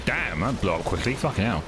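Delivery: usual speaking voice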